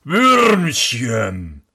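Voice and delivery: lightly voiced giant calling down; a hint of a booming giant. Giant calls down to the prince from his window, lightly voiced. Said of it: "rief er".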